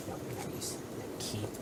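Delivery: whispered plea; whispering. Real, not acted. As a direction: breathe it out urgently.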